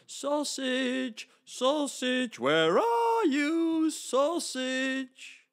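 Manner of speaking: high, loud calling through the forest. Acted. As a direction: call for the lost friend, loud and high. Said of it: "calling out".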